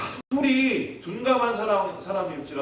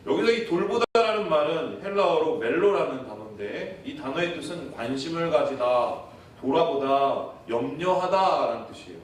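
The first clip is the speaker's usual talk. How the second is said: normal talking voice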